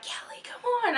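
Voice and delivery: high mock call to a friend. Friend calling her through the crowd, high and urging. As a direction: pitch it way up, beckoning.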